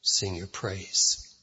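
hushed, low prayer voice; soft, humble pastoral prayer. Real, not acted. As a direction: pray quietly and reverently before preaching.

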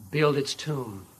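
ordinary talk

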